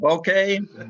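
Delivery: "Bokeh" ending in a "kay" sound; trying out pronunciation. Shown as a demonstration. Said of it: "How do you say this damn word".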